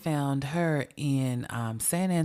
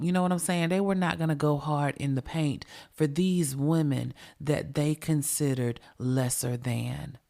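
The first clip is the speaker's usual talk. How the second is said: ordinary delivery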